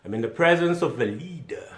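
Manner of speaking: hammed-up impressed woman; mock swooning woman. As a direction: gush like she's smitten. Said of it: "she realizes at this point that".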